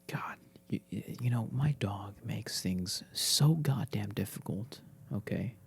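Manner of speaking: hushed whisper about the dog; a secret aside in a whisper. Real, not acted. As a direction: whisper it so the dog won't hear.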